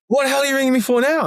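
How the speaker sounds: high, fast imitated outburst; acted grump woken at night; mock irate. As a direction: rattle off an indignant demand. Woken man snaps at caller.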